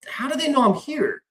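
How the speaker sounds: a mock panic at being found out; alarmed disbelief. Hiding from visitors, high panicked question.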